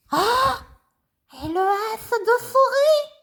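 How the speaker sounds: high, bouncy little puppet voice; high childlike puppet greeting the class. Acted. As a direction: play the cheeky puppet pupil. Ratoun puppet talking.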